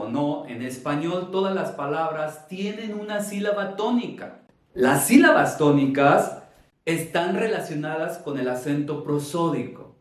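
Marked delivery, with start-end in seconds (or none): 4.7-6.4 louder stress on TÓNICAS and SÍLABAS; énfasis didáctico. Shown as a demonstration. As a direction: punch out "Las sílabas tónicas" loudly, like a teacher. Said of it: "Es esa fuerza de voz". (énfasis fuerte en las sílabas tónicas)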